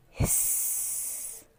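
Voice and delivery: long whispered hiss like a snake. Shown as a demonstration. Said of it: "Can you make the sound of a snake?".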